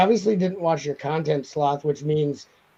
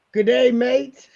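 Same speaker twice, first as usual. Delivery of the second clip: mock Australian; 8 semitones higher, 5 dB louder, slower than usual.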